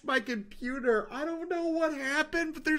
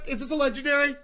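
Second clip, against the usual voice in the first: ordinary talk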